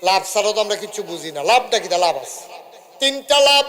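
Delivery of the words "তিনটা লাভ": called out. Real, not acted. Preacher announces benefits to crowd.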